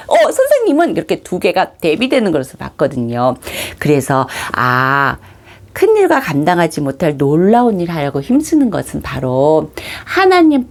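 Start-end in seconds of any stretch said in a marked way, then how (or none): none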